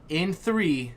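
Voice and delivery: usual speaking voice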